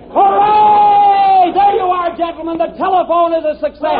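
triumphant shout. Acted. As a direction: cheer the invention's success. The telephone finally works.

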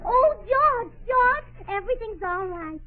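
high, gushing good news; excited. Acted. Wife tells George everything is all right, excited. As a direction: burst out with relief, pitched high.

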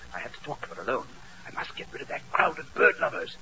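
low, soft mystery aside; quiet, scheming storyteller; hushed narration. Acted. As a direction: confide the scheme under your breath. Norbert narrates conspiratorially.

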